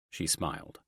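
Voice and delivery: plain reading voice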